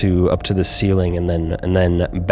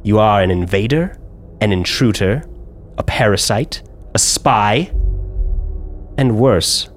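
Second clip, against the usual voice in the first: menacing drow noble. Acted. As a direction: lay out each accusation like a sentence passed. GM voices drow woman.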